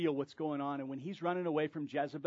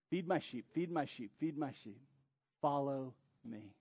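Jesus reassuring Peter, fading softer; tender, fading; 2 semitones lower, 4 dB quieter than usual. Acted. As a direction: let it fade to a tender invitation. Jesus repeats the charge softly.

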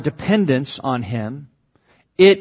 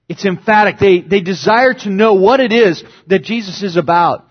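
ordinary delivery

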